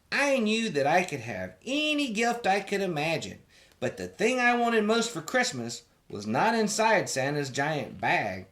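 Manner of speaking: usual speaking voice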